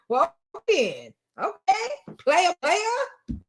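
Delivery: high, incredulous mimicry of a dad's weak reply; mock imitation. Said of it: "And the best you got is".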